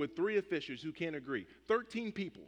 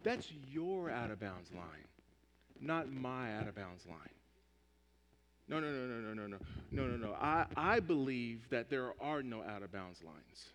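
smug dismissal; 7 semitones lower, 7 dB quieter than usual. Retold or imitated. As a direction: shrug the rule off, low and soft. Player dismissing the ref's line.

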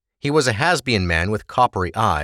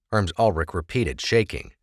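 ordinary delivery

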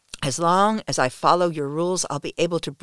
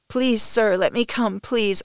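pleading cry; 5 semitones higher, 5 dB louder, slower than usual. Acted. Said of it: "I cry".

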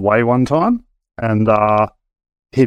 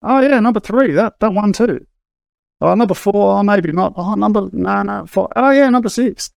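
mock joking; 8 semitones higher than usual. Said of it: "we were sort of joking".

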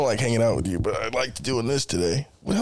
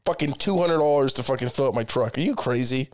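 ordinary delivery

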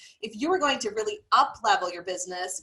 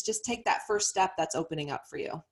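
usual speaking voice

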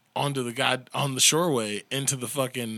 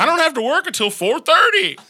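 much higher, louder mock come-on voice; mocking Kenny impression; 13 semitones higher, 9 dB louder than usual. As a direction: ham up a sleazy come-on.

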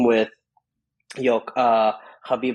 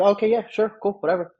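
high-pitched, careless string of yeses; offhand, noncommittal okay; breezy agreement; 10 semitones higher than usual. Retold or imitated. Acted offhand agreement, retold.

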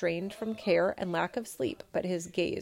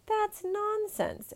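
much higher, slow indignant exclaim; much slower than usual. Acted. Emperor rejects the peasant's request, indignant exclaim. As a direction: scoff at the idea. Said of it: "exclaimed the emperor".

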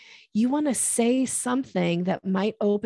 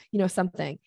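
ordinary talk

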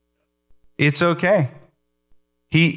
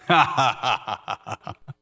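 God's easy laugh. Pretend. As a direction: laugh like nothing could trouble you. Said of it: "He goes".

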